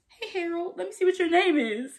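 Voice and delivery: high, cooing friendly voice; Rue to dog. Retold or imitated. Vlogger voicing Rue cooing at the dog Harold, high and friendly. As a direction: coax the dog gently.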